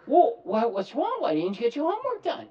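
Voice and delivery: loud, high, fast questioning; mock parent scolding. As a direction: press the kid for answers.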